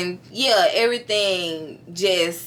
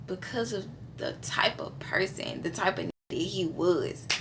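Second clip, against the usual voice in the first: whisper; 10 dB quieter than usual. Real, not acted. Lowered voice for a touchy remark.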